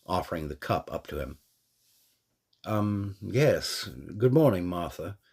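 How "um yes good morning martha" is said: a touch of awkward hesitation, slightly raised; unsure, halting hello; awkward reply. A narrator's reading. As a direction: caught off guard, mildly awkward greeting. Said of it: "he replied somewhat awkwardly".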